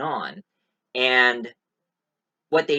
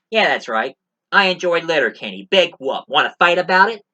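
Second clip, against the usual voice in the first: loud, playful challenge, daring anyone to argue; mock defiance; 5 semitones higher, 8 dB louder than usual. Defending his love of Letterkenny to viewers, loud mock challenge. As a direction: puff up like picking a fake fight.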